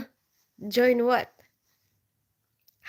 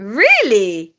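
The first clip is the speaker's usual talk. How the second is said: surprised really; 2 semitones higher, 9 dB louder, slower than usual. Real, not acted. Surprised at chat.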